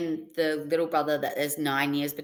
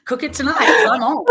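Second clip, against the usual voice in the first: laughing; 10 semitones higher, 9 dB louder than usual. Retold or imitated. Cheeky reply to mum, laughing.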